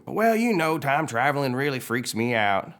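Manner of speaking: high, loud, rattled tow-truck character; Mater. Acted. Mater explains his fright to McQueen, rattled high voice. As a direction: play a spooked character explaining himself. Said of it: "Mater freaks out".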